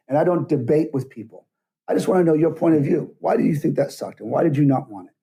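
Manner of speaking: ordinary delivery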